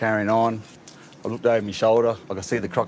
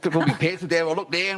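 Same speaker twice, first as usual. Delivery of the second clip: ordinary talk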